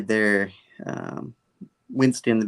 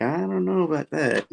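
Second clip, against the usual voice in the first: higher-pitched, dubious reply; retold skeptical doubt; 6 semitones higher than usual. Peter answering Norman's plea for trust, skeptical. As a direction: voice the wary doubt in a raised pitch.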